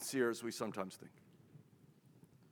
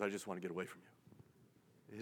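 hushed sigh; 8 semitones lower, 16 dB quieter than usual. Acted. God turning away, sighing.

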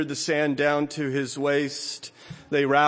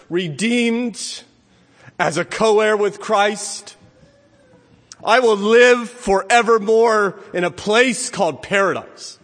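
high, loud, slow triumphant proclamation; fervent preaching; 6 semitones higher, 6 dB louder, slower than usual. Acted. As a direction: proclaim it like a rising shout of victory. Preaching at full force.

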